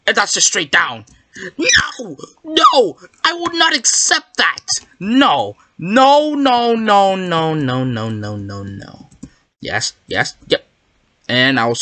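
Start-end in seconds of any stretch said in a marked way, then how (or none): 1.6-5.6 very high-pitched wail of refusal; squealed, indignant protest; protesting outcry. Real, not acted. Protesting a failed drop. 5.8-9.1 dismayed falling nos. Real, not acted. Protest after failing.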